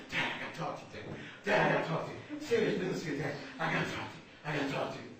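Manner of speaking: mock panting, words in short bursts; acted breathless, urgent child; out-of-breath kid. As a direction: gasp it out like a boy who just sprinted home. Child who sprinted home, to dad.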